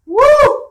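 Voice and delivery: loud high laughing woo; startled whoop. Retold or imitated. Seeing the glass in spotlight.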